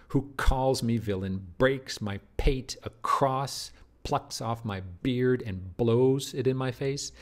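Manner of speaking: slow, punched K, P and B in "calls", "breaks", "pate", "plucks", "beard", "blows"; exaggerated plosives. Shown as a demonstration. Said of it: "If you exaggerate the sounds like that".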